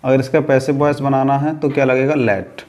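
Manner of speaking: ordinary delivery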